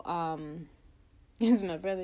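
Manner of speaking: ordinary delivery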